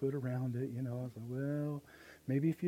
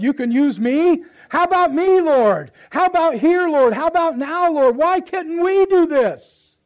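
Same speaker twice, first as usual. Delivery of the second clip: full-force preaching; 11 semitones higher, 15 dB louder than usual. Acted. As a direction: drive it home to the congregation.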